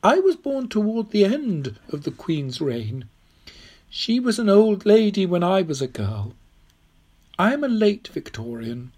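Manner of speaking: put-on high old lady's voice; old woman, explaining. Old woman correcting Tom about the dates, high and assured.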